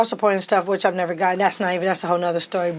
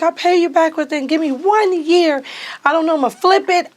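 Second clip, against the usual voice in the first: higher and louder, determined; raised, confident pledge; eager promise; 9 semitones higher, 4 dB louder than usual. Retold or imitated. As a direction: promise with excited resolve. Promising to repay dad.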